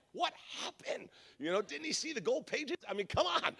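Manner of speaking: whispered disbelief. Retold or imitated. Driver watching the officer write a ticket, whispered disbelief. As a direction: whisper it under your breath in disbelief. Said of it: "And I'm like".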